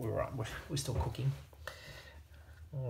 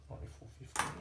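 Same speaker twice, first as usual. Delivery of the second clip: breathy whispered mutter, softer; whispered to himself; 7 dB quieter, faster than usual. Real, not acted. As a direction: mutter the number under your breath while searching. Mutters oil number to himself.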